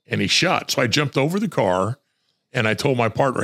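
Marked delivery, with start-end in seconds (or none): none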